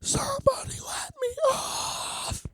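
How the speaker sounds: high, strained whispered cry, soft but desperate; whisper-scream it like you're trapped on the train; breathless whispered plea with a cry in it; strangled inner scream. Retold or imitated. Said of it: "in my head, I'm like".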